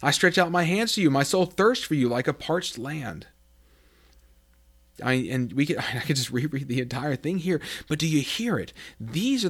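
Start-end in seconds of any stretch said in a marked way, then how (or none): 0.0-3.3 yearning plea. Acted. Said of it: "picking a few verses".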